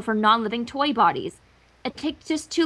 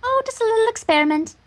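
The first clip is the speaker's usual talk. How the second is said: raised, airy refined voice; Rarity voice; 8 semitones higher than usual. Acted. Rarity coyly deflecting Applejack's question. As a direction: play innocent.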